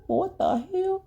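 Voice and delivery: a high mock-horrified shriek; shrieked disbelief. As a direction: squeal it like you're scandalised. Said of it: "I was like".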